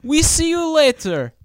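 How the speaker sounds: put-on high Borat accent sign-off; over-the-top Borat accent. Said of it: "Sign off the podcast and Borat voice".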